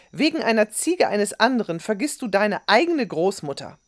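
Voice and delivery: loud, high, indignant scolding; schimpfend. A narrator's reading. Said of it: "schimpfte Amina". (empört und laut schimpfend)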